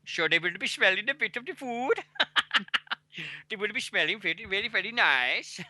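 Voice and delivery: high-pitched hammed-up Dutch accent; bad Dutch accent. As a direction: put on a terrible Dutch accent. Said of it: "That is my shit attempt at a Dutch accent".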